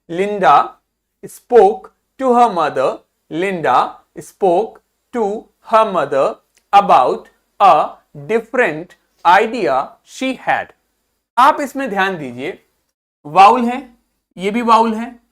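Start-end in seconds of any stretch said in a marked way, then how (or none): 0.1-10.6 very slow, phrase by phrase with pauses; deliberate chunks, a gap after each; read-along demonstration. Shown as a demonstration. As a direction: model the sentence slowly for learners to repeat. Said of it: "मेरे साथ में इसको बोलिए".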